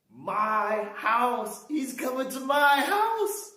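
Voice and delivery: high astonished questions, slowing; can't believe he's coming; stunned host hearing Jesus will visit; surprised. Acted. Zacchaeus amazed Jesus is coming.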